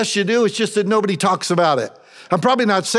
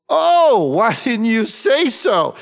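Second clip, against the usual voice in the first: loud, much higher burst of surprise; a sudden exasperated aha; mock surprise; 5 semitones higher, 4 dB louder, slower than usual. You finally seeing what God did, loud surprised realization.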